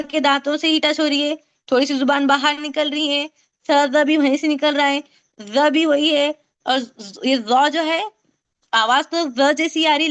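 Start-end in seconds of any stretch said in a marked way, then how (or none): none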